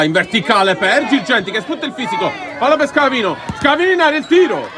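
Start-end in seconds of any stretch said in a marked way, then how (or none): 2.6-4.7 excited shot call. Real, not acted. Shot on goal, live commentary.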